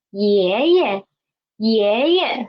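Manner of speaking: teacher's model 爷爷, second 爷 stepping down. Shown as a demonstration. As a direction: lift first 爷, then drop the second. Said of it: "so lower too".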